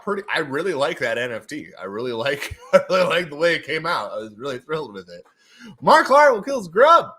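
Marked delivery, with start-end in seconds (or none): 5.8-7.1 loud, high announcer call; hyped winner announcement. Acted. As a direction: belt out the winner's name.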